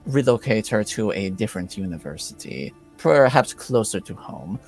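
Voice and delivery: put-on measured accented nobleman with long pauses; lightly put-on courteous lord; Lord Vargas in character. Vargas explaining why he came for his daughter, measured lord.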